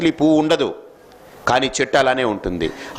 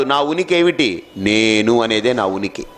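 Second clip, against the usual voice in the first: ordinary talk, nothing marked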